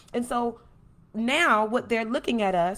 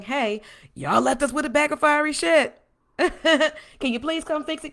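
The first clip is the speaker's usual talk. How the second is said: raised, higher mock complaint; acted-out hollering to those in charge; 5 semitones higher, 4 dB louder than usual. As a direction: call out a hammed-up grievance. Acts out voters complaining to politicians.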